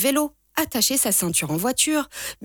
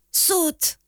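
higher pitched call of "Saute"; called out again; 8 semitones higher than usual. A narrator's reading. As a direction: urge "Saute" upward, pressing. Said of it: "crie encore mon frère".

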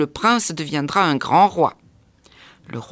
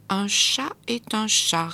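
slowly repeating; 4 semitones higher than usual. A narrator's reading. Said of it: "répéta Petit Prince Pouf".

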